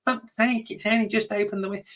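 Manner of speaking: high, fast, exasperated fan voice; mocking an impatient fan. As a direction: squeak it out like a whiny critic. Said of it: "before anyone else says it".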